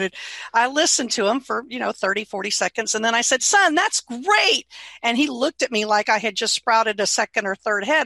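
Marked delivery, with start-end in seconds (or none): 3.4-4.7 high, drawn-out praise; delighted approval. Retold or imitated. Mother to son who hates his job, delighted and drawn-out. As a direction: stretch it out with big enthusiasm. Mother cheering son's complaint.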